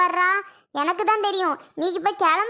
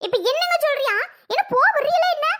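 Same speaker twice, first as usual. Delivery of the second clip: indignant outburst; 7 semitones higher than usual. Acted. Wife to husband who praises her, indignant outburst. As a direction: rise up in outrage at him.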